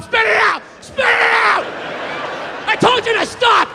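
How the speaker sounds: high, loud bellowed orders; angry shouting. Acted. Private chef to a cheating dieter, furious shouting. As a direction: yell it like a furious drill-sergeant chef.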